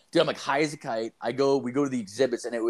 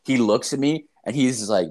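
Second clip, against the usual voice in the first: normal talking voice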